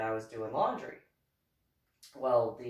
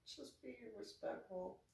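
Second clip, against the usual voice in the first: soft, higher, rising at the end; soft repeat; 6 semitones higher, 14 dB quieter than usual. Shown as a demonstration. Vlogger repeating her gentle reminder to viewers. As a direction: repeat the reminder softly with a lift.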